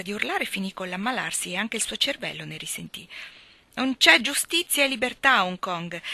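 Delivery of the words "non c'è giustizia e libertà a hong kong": louder and higher, raving; loud raving shout. A narrator's reading. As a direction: bellow it, wild-eyed.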